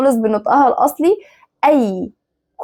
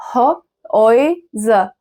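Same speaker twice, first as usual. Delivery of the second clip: very slow Häuser, pulled apart into pieces; syllable by syllable; much slower than usual. Shown as a demonstration. Teacher spelling out the plural for learners, very slow Häuser. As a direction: sound out Häuser bit by bit with gaps.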